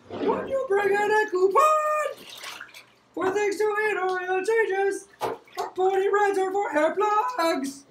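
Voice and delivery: high, slow squeaky rat commercial voice; squeaky sewer rat voice. Retold or imitated. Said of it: "I can't do the sewer rat voice".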